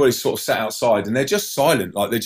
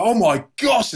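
high-pitched alarmed exclamation; mock panic; 11 semitones higher than usual. Passenger to the man next to him in the dive, high alarm. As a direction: act out shock, voice leaping up. Retold shock in falling plane.

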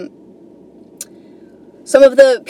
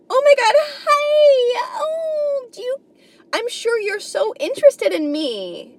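squealing, much higher, gushing hello; mock cheery tourist; 14 semitones higher, 4 dB quieter than usual. Airbnb guest arriving in New York, gushing mock squeal. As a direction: gush like an overeager tourist meeting her host.

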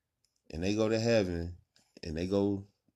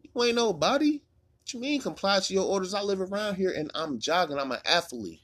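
imitating the jogger; 11 semitones higher than usual. A man stopped by cops answering back, high outraged voice. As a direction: squeak it out like the indignant jogger.

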